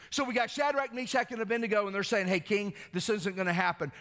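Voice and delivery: high, loud, driving preacher voice; full-force preaching. Acted. Pastor rallying his congregation, high loud preaching. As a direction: lift the room, preach it hard.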